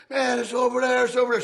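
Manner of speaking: high, loud, frantic pointing voice; panicked pleading. Retold or imitated. Debtor to the knife man, frightened and frantic. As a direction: blurt it out in panic.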